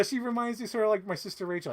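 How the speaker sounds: ordinary talk, nothing marked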